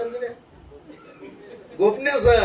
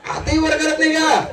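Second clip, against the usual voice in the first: mock outrage, higher and louder; louder teasing; 8 semitones higher, 6 dB louder than usual. Real, not acted. Poet teases the audience, loud mock outrage. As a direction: prod the silent crowd.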